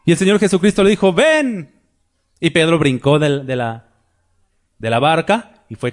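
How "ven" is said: high, sustained call; imitated beckoning call; calling summons. Jesus calls Peter onto water.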